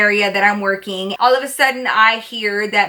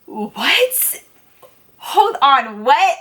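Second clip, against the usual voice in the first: high, halting shocked double-take; pause, then blurt it in acted disbelief; stunned disbelief; 5 semitones higher, much slower than usual. Waitress hearing the offer to buy her off the floor, stunned.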